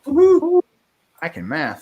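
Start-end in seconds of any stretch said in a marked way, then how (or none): 0.1-0.6 excited. Real, not acted. Cheering about the math.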